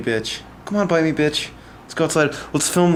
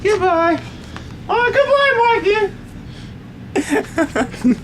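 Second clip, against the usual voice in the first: high singsong goodbye; slower than usual. Real, not acted. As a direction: sing goodbye to the bird. Farewell to the bird.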